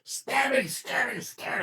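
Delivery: high-pitched, squeaky battle chant; frantic mock stabbing. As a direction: jab out each repeat in a high voice. Private mock-stabs an ambushing German.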